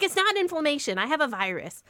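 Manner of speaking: exasperated protest. Retold or imitated. Daughter arguing with her parents.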